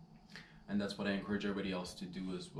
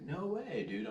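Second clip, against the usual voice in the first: mock disbelief; 8 semitones higher, slower than usual. Stranger refusing to believe the jacket is Zara, mock disbelief. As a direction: play the stranger's hammed-up disbelief. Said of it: "He's like".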